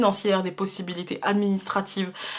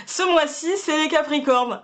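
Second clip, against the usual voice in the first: excited; 7 semitones higher than usual. Real, not acted. Delighted by best card.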